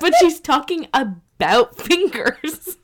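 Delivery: laughing through the words, slow; talking while cracking up. Real, not acted. Laughing at the song.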